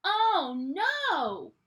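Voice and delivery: usual speaking voice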